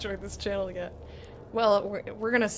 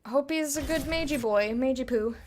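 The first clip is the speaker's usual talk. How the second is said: usual speaking voice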